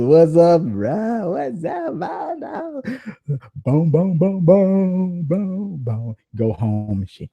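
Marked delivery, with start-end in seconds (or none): 0.0-2.8 mock bro greeting. 3.6-6.2 mock handshake. Mimed handshake noises.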